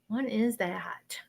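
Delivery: slow, hushed whisper; real self-directed whisper. Real, not acted. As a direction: whisper it to yourself, puzzling. Puzzling over a vision to herself.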